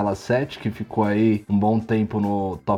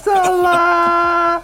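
excited shout; 3 dB louder than usual. Pretend. Exclamation high far.

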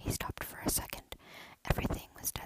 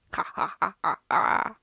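loud maniacal cackle; evil laugh; 5 semitones lower, 14 dB louder than usual. Acted. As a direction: cackle like a madman. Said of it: "He let out a snicker".

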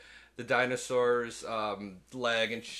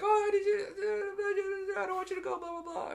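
mock crying woman. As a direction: whine it like a tearful girlfriend. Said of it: "she's like".

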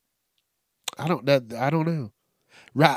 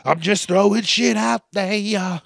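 louder; 6 semitones higher, 4 dB louder than usual. Real, not acted. Redo after clearing throat.

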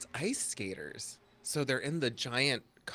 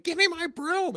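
high, loud witch demand; 8 dB louder than usual. Retold or imitated. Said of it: "she's like".

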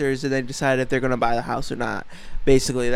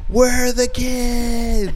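slow, high, louder mock wail; hammed-up whispered cry; 7 semitones higher, 7 dB louder, much slower than usual. Parodying ASMR whisper.

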